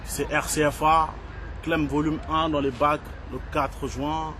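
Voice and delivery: slow, soft closing plug, fading away; promo sign-off. Acted. Rapper signing off his album promo, soft and slow. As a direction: wind the promo down gently.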